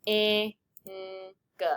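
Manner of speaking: "I, N, G" as three split sounds; short i, n, then g, with gaps; sounded out separately. Shown as a demonstration. Teacher testing how many phonemes, sound by sound. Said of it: "ing only has one phoneme".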